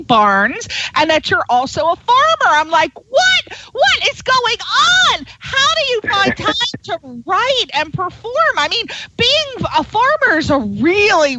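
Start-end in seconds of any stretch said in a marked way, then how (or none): 3.1-8.3 high-pitched, playfully incredulous outburst; astonished disbelief. Retold or imitated. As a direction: react as if you can't believe it. Acted astonished reaction to guest's workload.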